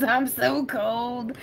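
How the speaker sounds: slow, pitiful little whine; a hint of a shivering kid; whiny cold voice. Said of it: "Penny says".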